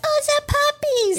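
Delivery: very high, gushing puppy voice; baby talk. Retold or imitated. Trainer greeting young puppies, high cutesy baby talk. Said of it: "earn the baby talk".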